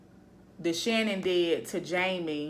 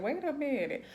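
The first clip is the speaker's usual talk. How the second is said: high, suspicious "wait a minute"; catching something odd, pitch shooting up; mock double take; 7 semitones higher than usual. Viewer doing a double take at the show, raised suspicious voice. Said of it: "you be sitting there like".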